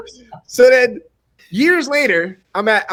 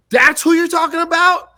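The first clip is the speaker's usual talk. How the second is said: high, raised-voice incredulous question; acted-out amazed realization; astonished reaction; 10 semitones higher, 4 dB louder than usual. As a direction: replay your own disbelief. Retelling his astonished reaction.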